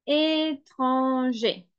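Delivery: "étranger" stretched out syllable by syllable; slow dictation. Shown as a demonstration. Teacher dictating "étranger" to learners, slow syllable-by-syllable delivery. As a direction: draw "étranger" out very slowly. Dictates word slowly, then repeats normally.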